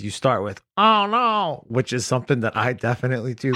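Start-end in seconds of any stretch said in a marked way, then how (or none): 0.8-1.6 high, mocking fan voice; a mock squeaky whine; squeaky falsetto mockery. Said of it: "whenever you do one of their voices".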